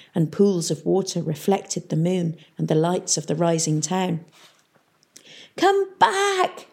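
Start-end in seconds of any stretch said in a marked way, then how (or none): 5.6-6.7 high-pitched desperate call; shouting. A narrator's reading. Peavy shouting after the town from ahead, high and desperate. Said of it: "they could hear Peavy shouting".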